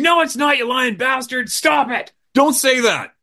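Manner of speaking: high, outraged shouting; mock screaming. Speaker screaming at Tut to stop lying, outraged. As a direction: scream your denial at him. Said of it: "now I'm screaming".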